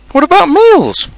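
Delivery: high, loud, distressed question; gloomy. A narrator's reading. Pooh worrying about going hungry, gloomy. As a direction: wail the worry about food.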